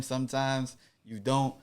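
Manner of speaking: normal talking voice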